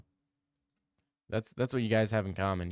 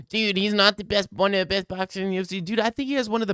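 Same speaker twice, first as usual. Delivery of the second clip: high, quick mock of the critics; mocking doubters; 8 semitones higher, 4 dB louder, faster than usual. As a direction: mimic the naysayers, a bit whiny. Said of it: "people literally call me and saying".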